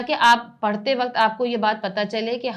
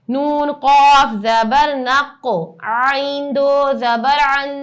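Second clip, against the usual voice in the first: slow sing-song letter-by-letter spelling; qaida spelling drill; 3 semitones higher, 6 dB louder, much slower than usual. Acted. Teacher spelling words aloud for her students in a drill. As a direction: chant each letter and vowel for pupils to copy.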